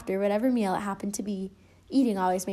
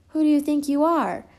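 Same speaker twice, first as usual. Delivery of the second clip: higher, louder whistly gopher; whistled gopher line; 6 semitones higher, 6 dB louder than usual. Acted. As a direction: whistle it through the teeth like a gopher. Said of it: "whistled Gopher".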